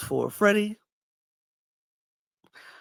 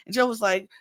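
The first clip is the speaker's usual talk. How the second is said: ordinary talk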